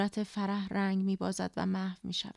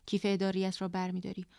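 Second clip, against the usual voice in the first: ordinary delivery, nothing marked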